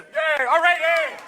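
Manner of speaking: high, loud whooping cheer; mock cheering. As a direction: cheer like an over-keen fan. Mock cheering for Canadian hockey win.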